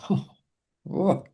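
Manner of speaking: hushed, awed reaction, retold; gobsmacked. Mentor retelling his reaction to the gift of sight idea, gobsmacked. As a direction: let a stunned "whoa" escape after a pause. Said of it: "I'm absolutely gobsmacked by that".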